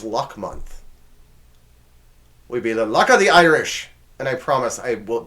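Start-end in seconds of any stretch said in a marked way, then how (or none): 2.5-3.9 louder, higher, hammed-up Irish accent; bad Irish accent. Shown as a demonstration. Host teases next month's luck theme, bad Irish accent. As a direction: put on a poor Irish brogue. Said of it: "don't have a better Irish accent".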